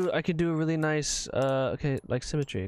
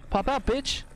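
high taunting; 13 semitones higher than usual. Pretend. Repeated taunt, higher.

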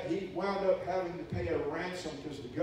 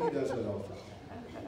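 mock weary churchgoers; 6 semitones lower, 5 dB quieter than usual. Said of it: "rolling their eyes going".

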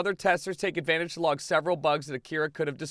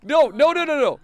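very high, loud rapid-fire protests; panicked yelling; 13 semitones higher, 8 dB louder than usual. Real, not acted. Player told the dog is running away, panicked yelling. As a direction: yell frantically, repeating words in panic.

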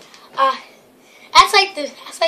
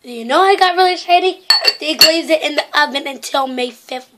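ordinary talk

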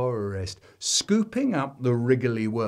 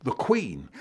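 ordinary delivery, nothing marked

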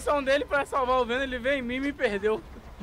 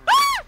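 sudden high loud scream; a real startled shriek; 9 dB louder than usual. Real, not acted. Caught by the killer, a sudden shriek. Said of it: "ainda não comecei a gritar".

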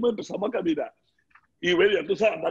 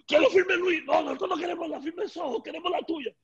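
quarrel voiced; 10 semitones higher than usual. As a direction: play the fans insisting, pitched up. Voicing Jeter and the girls arguing.

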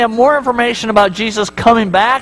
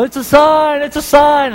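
lightly voiced frantic prophet, pitched higher; a hint of a wild-eyed doomsayer crying out; mock raving man shouting his omen; crazy man; 7 semitones higher, slower than usual. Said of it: "the crazy dude's walking around going".

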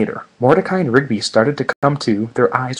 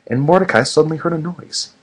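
ordinary talk, nothing marked

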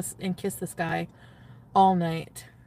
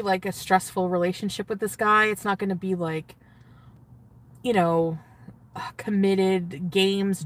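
ordinary talk, nothing marked

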